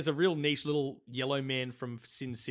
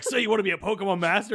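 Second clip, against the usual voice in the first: loud, higher, rushed imitation of the lyric; mock song quote; 7 semitones higher, 6 dB louder, faster than usual. Comic imagining the song hailing a crowd, loud mock hype. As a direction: fire the question out fast and loud, mocking. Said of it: "It's like".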